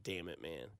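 low, hushed mutter under the breath; muttered curse. Retold or imitated. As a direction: mutter it so the table can't hear. Caught talking too loud.

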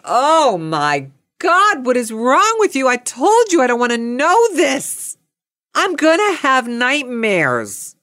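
high, exasperated wail; dramatic, high-pitched protest; horrified outburst. Retold or imitated. Acted horrified outburst at exterminator.

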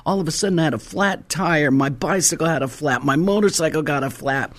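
ordinary delivery

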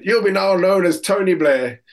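a hint of a corporal's decree; corporal announcing nickname. Corporal giving recruit his nickname, lightly mock-official. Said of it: "the corporal said".

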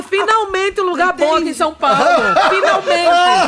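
high, loud, laughing, stretched final word; drawing out finalmente in glee; thrilled relief. Retold or imitated. Telling Sato São Paulo finally has a good place, thrilled and laughing. Retelling own naive excitement.

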